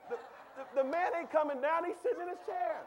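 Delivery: high and loud, laughing through it; laughing preaching. Real, not acted. Preacher laughing at a pushy evangelist, loud laughing. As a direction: laugh at the picture while you say it.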